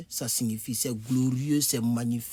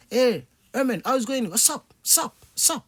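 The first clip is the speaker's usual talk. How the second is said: much higher, bright mock American "hey Herman"; breezy American greeting; 9 semitones higher, 4 dB louder than usual. Teacher imitating an American greeting Herman, much higher. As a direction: play a cheerful American hailing a buddy. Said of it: "Il dit".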